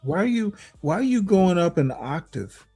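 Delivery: usual speaking voice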